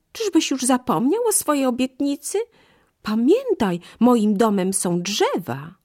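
high, loud, breathless pleading; gasping frightened monkey. Acted. Half-drowned monkey pleading with the crocodile, high and panicked. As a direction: scold him while still catching breath. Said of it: "sapnęła".

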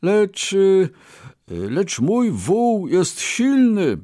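high, slow, halting boast; stumble, then boast with pitch high; boasting Brahmin. A narrator's reading. Brahmin praises his ox to merchant, boasting.

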